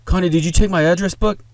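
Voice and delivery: put-on loud, high-pitched call to a child; frantic calling out. A narrator's reading. Mother hunting for her address book, frantic call. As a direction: call urgently across the house.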